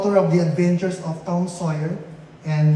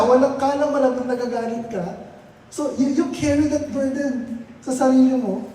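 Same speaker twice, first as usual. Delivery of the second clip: ordinary talk, nothing marked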